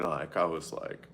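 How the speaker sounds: ordinary delivery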